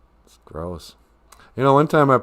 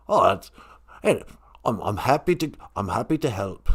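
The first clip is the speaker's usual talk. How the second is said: lightly voiced obliging spirit; Great Spirit character; 4 dB quieter than usual. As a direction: answer as the oddly accented Great Spirit. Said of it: "accent is from".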